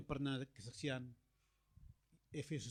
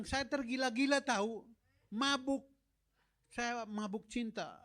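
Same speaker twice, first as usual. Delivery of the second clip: lovestruck gushing; 7 semitones higher, 4 dB louder than usual. Retold or imitated. Wife confessing infatuation.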